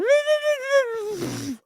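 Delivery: high-pitched mocking kid babble; high, falling mock whine; mock childish whining noise. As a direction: imitate whiny little kids without words. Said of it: "little kids who act like".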